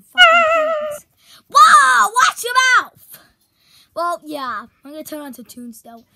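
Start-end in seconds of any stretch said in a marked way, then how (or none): 0.0-1.1 high, loud, fast outrage; outraged shout. Acted. Outraged objection. 1.5-3.2 high, loud telling-off; scolding shout. Acted.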